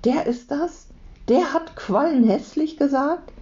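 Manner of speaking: high-pitched, incredulous questions; astonished child, pitched high; amazed. A narrator's reading. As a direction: ask in mounting disbelief, voice way up. Leo amazed at the revelation.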